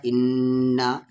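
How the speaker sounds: इन्ना slow, the doubled n hummed long; held ghunna. Shown as a demonstration. Teacher demonstrating ghunna, इन्ना with lingering nasal n. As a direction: hold the न्न of इन्ना in the nose. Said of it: "शद है तो गुन्ना होगा".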